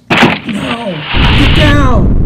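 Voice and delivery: usual speaking voice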